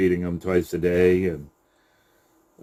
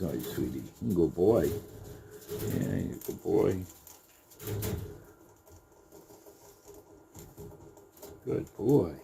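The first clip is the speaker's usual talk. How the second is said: quiet, cooing praise; calm the rabbit with soft praise; gentle murmured sweet talk to a pet; 10 dB quieter than usual. Real, not acted. Soothing rabbit while showing.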